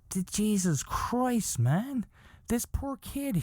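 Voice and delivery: high-pitched appalled reaction. Retold or imitated. Comic retelling his reaction to the kid's fate, appalled. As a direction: voice climbing in horrified pity.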